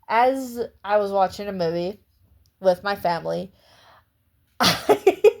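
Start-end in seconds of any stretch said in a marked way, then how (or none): 4.1-5.3 laughing. Real, not acted. Laughing at the memory.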